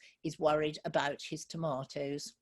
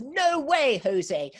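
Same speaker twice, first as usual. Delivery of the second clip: louder, cheeky singsong no way Jose; cheeky refusal; 7 semitones higher, 6 dB louder, much slower than usual. Acted. Field mouse turning down little rabbit, louder and cheeky. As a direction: tease him with a flat refusal.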